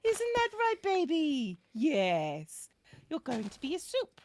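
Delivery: put-on high, cooing baby talk to a pet; loving pet-talk voice. Shown as a demonstration. Cat owner sweetly threatening their cats, in a cooing loving voice. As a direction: coo a threat sweetly, as if doting. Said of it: "in a loving voice".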